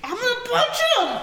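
high, loud, laughing threat; defiant outburst. Acted. Hothead character insisting on a punch to her companion, defiant. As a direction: burst out with hammed-up fighting talk.

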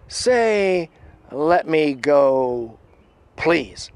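imitated slow, loud demand; Critter. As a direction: draw the order out, one piece at a time. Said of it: "Critter's voice goes".

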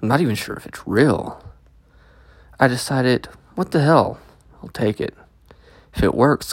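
usual speaking voice

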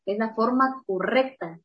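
usual speaking voice

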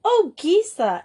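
high, excited exclamation with a falling end; exclaiming. A narrator's reading. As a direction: greet a friend with surprised delight. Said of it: "exclaimed Grizel".

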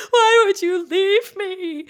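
high, loud, slow crying plea; mock panicked wail. Panicking son begging his mother not to leave, crying. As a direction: wail it like you're being abandoned.

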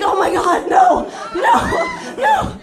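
very high, laughing no; laughing mortified shriek; horrified shriek. Retold or imitated. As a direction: squeal in embarrassed horror. Horrified seeing commercial.

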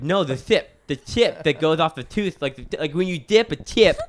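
insistent lisp, high and loud. Retold or imitated. Explaining chip to the puzzled receptionist, frustrated lisping. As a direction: push "tip" out as a lisped "thip". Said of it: "I'm, like, lisping".

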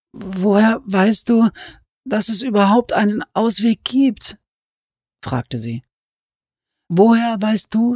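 doubting and hopeless; despairing Alice. Acted.

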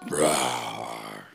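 deep growling roar; lion roar. Acted. Caged lion roars at Ralphie, deep growl. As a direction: roar to warn him off.

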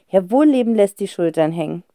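ordinary delivery, nothing marked

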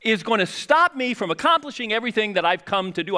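Jesus scolds doubting disciples after the storm, exasperated and high. Retold or imitated. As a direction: play Jesus fed up with his disciples, high and loud.

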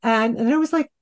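high-pitched, giggly exclamations of relief; laughing mock gratitude. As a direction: squeal it through laughter, thanking mom in retelling. Laughing retelling of her reply to mom.

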